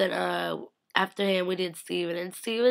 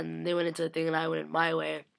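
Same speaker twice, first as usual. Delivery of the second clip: usual speaking voice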